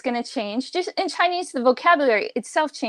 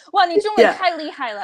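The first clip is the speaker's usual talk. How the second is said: higher, fast mock amazement; gushing praise; 7 semitones higher, 4 dB louder, faster than usual. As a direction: gush like an easily impressed native speaker. Acted native speaker's amazed praise.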